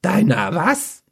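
slow, high, puzzled question; disbelieving mage asking what; baffled. Acted. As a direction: mage mocks the strange word, baffled.

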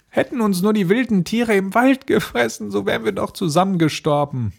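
high-pitched, wailing little sister; lamenting girl. Acted. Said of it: "rief sie aus". (jammerndes kleines Mädchen)

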